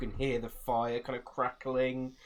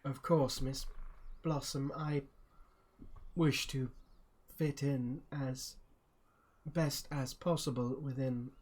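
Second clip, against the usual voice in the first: slow, halting, slightly raised character voice; Snooper in character; 4 semitones higher, slower than usual. Acted. Snooper politely agrees with Miss Blossom, halting character voice. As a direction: lift the pitch and pause between phrases. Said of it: "Snooper's just like".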